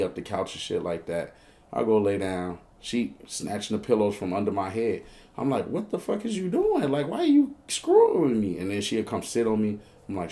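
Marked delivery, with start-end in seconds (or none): 5.7-8.6 high-pitched whining complaint; retold protest, voice pushed up high; exasperated protest. Man whose pillows she snatches, exasperated protest.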